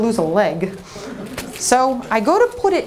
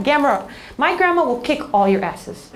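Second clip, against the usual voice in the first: usual speaking voice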